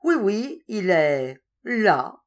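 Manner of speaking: tease, stretching the last words slowly; mischievous teasing. A narrator's reading. Warder playing a prank about the cockchafer, mischievous teasing. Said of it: "Il commit une espièglerie".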